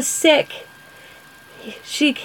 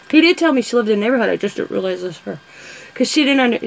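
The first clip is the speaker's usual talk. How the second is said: ordinary delivery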